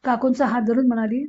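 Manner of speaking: usual speaking voice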